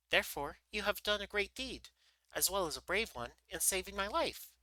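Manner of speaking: high little mouse-queen voice. A narrator's reading. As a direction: speak as a tiny queen, pitched high. Mouse queen's dialogue in story.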